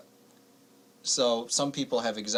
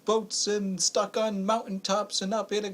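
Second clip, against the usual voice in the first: imitating her, higher frantic voice; mock doomsday panic; 9 semitones higher than usual. Host imitating a caller panicking about 2012, higher mock panic. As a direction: rattle off the doom a little high. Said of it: "It's like".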